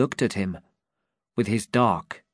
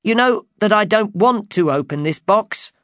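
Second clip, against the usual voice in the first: high, loud protest; driver cries out; 11 semitones higher, 6 dB louder than usual. Acted. Driver cries out to the king's men, loud. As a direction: cry out against the order. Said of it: "the driver cried".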